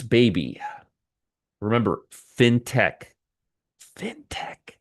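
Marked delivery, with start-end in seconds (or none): 4.0-4.8 hushed, voiceless stage whisper. Real, not acted. Reminding viewers what sector this is, whispered. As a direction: whisper it like a secret.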